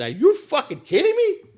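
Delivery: high, loud, slow outraged yell; retold furious shout. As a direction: shout it back in disbelief. Son snaps back at father.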